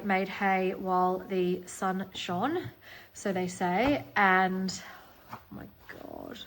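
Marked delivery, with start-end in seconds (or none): 5.3-6.4 a hushed, amazed oh my God; awed whisper. Real, not acted. Gasps over the magazine.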